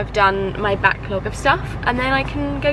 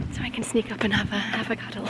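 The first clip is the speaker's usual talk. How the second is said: usual speaking voice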